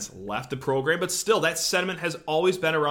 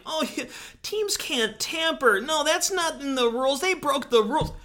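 pouty whiner; 11 semitones higher than usual. Retold or imitated. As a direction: play a sulky fan crying foul. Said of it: "go all pouty face being like".

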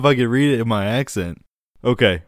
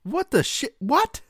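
high-pitched incredulous questioning; stunned, voice shooting way up; baffled disbelief; 4 dB quieter, slower than usual. Real, not acted. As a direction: squeak it out in bewilderment. Baffled reaction to absurd law.

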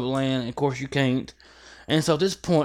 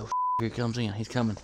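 hushed whisper, almost no voice; 3 semitones lower, 8 dB quieter than usual. Retold or imitated. Hunter in a tree retelling his whisper as the bear approaches. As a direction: breathe it so the animal won't hear.

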